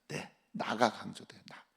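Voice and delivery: usual speaking voice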